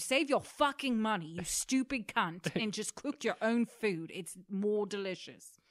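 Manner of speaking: loud, slow encouraging chef rant; aggressively positive. Pretend. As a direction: yell praise like a furious coach. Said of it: "aggressively in a positive way".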